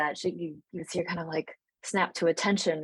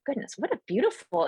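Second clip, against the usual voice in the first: gushing elder; 8 semitones higher than usual. Acted. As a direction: coo over the youngster like a delighted grandmother. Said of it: "Christine, like".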